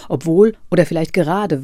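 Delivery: usual speaking voice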